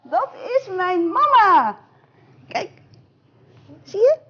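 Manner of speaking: high, loud, happy cry; overjoyed to find mama; joyful owlet. Acted. Owlet finding mama at last, joyful.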